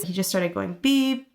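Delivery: normal talking voice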